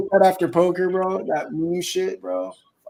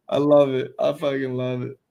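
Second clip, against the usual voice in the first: ordinary talk, nothing marked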